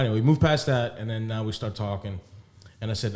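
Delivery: ordinary delivery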